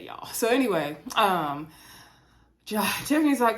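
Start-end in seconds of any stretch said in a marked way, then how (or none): none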